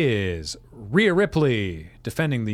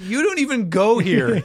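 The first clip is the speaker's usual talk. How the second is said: sassy quote; 6 semitones higher, 3 dB louder than usual. Retold or imitated. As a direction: deliver it like a Mean Girls burn.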